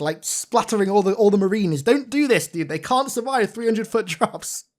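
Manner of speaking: ordinary talk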